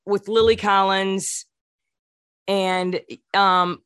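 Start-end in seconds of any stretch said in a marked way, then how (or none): none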